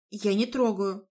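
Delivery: plain reading voice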